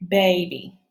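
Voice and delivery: ordinary delivery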